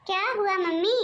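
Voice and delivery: ordinary talk, nothing marked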